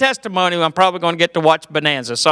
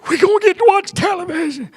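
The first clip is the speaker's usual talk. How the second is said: preacher dad; 8 semitones higher than usual. Retold or imitated. Preacher dad announcing the new television, high. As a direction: proclaim it from the pulpit. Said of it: "finally he weighed in".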